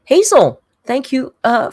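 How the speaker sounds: ordinary talk, nothing marked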